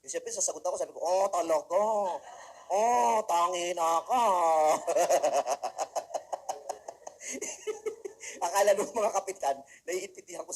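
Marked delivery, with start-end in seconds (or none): 1.0-4.2 slow, loud imitation of the captains' Japanese accent; drawn-out mock Japanese-accented reply. Answering captains in their style. 4.3-8.4 loud rising laughter; real laugh; long laugh. Real, not acted. Laughing at own joke.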